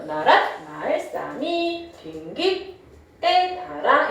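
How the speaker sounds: "나랏, 말, 싸미" slowly intoned along the tone dots; 중세국어 성조 낭독. Shown as a demonstration. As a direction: follow the tones, gliding up on "싸미" and "달아". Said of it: "이렇게 읽었단 얘기야". ("나랏, 말, 싸미, 빙기"를 방점 따라 읊기)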